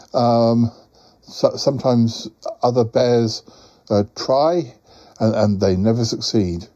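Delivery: normal talking voice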